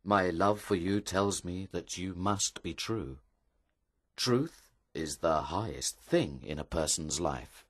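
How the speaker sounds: grave, softened reassurance; soft speaking. Acted. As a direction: speak gently, without anger. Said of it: "spoke softly to her".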